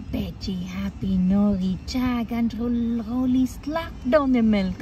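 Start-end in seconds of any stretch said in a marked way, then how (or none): none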